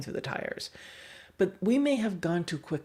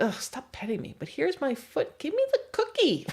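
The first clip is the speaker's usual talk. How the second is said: rising to a high whiny plea; voicing the dog; 10 semitones higher than usual. Trainer voicing a pestered dog, ending in a high plea. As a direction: start annoyed, end in a high squeaky beg. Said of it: "the dog is like".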